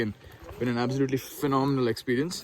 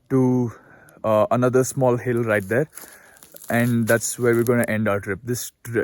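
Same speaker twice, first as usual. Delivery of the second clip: ordinary talk, nothing marked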